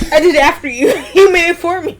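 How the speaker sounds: laughing protest. Real, not acted. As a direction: argue while cracking up. Arguing over sound while laughing.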